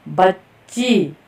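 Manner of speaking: बच्ची said very slowly with a doubled च्च; slow careful pronunciation. Shown as a demonstration. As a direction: hold the half च in बच्ची. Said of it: "हमने बच्ची इसका उच्चारण करना है".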